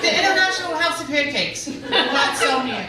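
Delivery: high, loud, laughing disbelief; dumbfounded. Real, not acted. Comedian retelling pancake refusal, high laughing disbelief. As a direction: pitch it up in baffled outrage. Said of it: "because I'm still dumbfounded".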